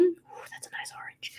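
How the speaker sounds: breathy delighted whisper. Real, not acted. Delighted aside while crafting.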